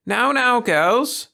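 high, loud, cheery schoolmistress; cheery call. A narrator's reading. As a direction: call out brightly to a group of children. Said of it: "called Miss Burton cheerily".

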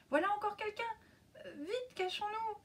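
high, startled and fearful; frightened. Acted. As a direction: whimper as if scared. Anna hides in fear.

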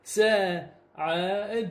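very slow spelled-out سعد; drawn out word. Shown as a demonstration. Teacher reading the word without vowels, very slowly. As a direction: draw سعد out slowly, sound by sound. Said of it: "بس هسه نقول بدون حركات".

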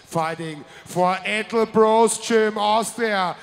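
loud, booming fight announcer; ring announcer. Acted. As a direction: announce it like a ringside MC. Ring announcer introducing red corner.

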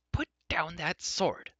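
high, drawn-out indignant command; indignant girl's cry, slowed for emphasis. A narrator's reading. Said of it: "Elodie cried".

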